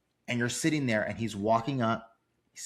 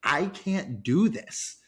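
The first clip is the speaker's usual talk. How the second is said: slow, higher, choked-up mock sob; retold crying; 6 semitones higher, much slower than usual. Fan retelling his tears at the movie, slow choked-up voice. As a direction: drag it out like you're welling up. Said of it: "I was, I was crying".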